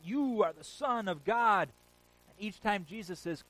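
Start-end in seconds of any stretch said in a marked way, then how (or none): 0.0-1.7 demons crying out. Retold or imitated. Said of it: "the demons cry out".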